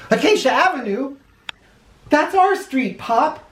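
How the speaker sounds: put-on high, amazed child's voice; can't believe it's our street; excited kid. Boy telling Pop the president is on their street, high and amazed. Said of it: "I said as we jogged".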